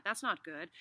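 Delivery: plain reading voice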